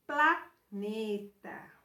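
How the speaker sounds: slow "planeta", a break after PLA; syllables sounded out. Shown as a demonstration. Teacher helping pupils hear the L sound, syllables sounded out. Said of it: "em voz alta para perceber os sons".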